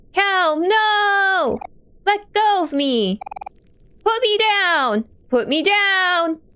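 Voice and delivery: high, desperate shouting; panicked struggling. Acted. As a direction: scream to be let go. Captive struggles, screams.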